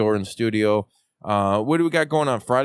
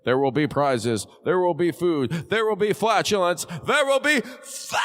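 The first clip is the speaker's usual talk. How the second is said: each line climbing higher to a shouted fun; building hype; 4 semitones higher than usual. Shown as a demonstration. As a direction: ramp it up line by line. Promo building up.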